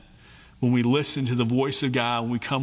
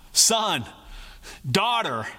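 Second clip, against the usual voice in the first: father calling out; 6 semitones higher, slower than usual. Retold or imitated. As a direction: call your son and daughter home, rising high. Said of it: "It's like a father calling out".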